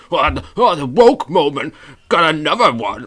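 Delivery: high, loud wailing parody of angry people; mock outrage. Host mimicking people angry over a removed cartoon, mock outrage. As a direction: overblown indignant griping. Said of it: "people getting angry about a cartoon character".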